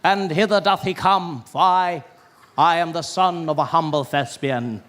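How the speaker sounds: grand, slow theatrical thespian voice; pompous stage actor. Acted. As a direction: ham it up like an old Shakespearean actor. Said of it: "actors just walking out there with their big collars".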